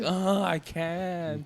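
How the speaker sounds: high, drawn-out mock whine; whining kid. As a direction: whine like a child dodging a chore. Acting kids refusing to help.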